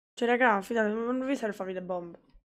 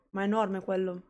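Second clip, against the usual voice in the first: ordinary talk, nothing marked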